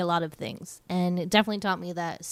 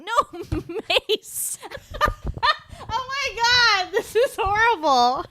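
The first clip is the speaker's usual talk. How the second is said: high, slow words squeezed out through laughter; laughing hard; 3 dB louder, slower than usual. Real, not acted. Host cracking up at the can of mice joke, laughing hard. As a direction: gasp the words between laughs.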